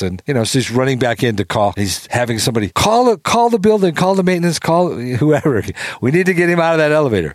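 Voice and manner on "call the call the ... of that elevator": urgent shouting. Retold or imitated. Spector panicking, calling for help.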